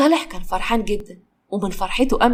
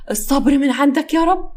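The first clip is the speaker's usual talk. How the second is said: higher and louder, begging heaven for patience; exasperated plea; 5 semitones higher, 4 dB louder than usual. Acted. The boss, fed up with Malak, pleads to God in exasperation. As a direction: throw up your hands, give me patience Lord.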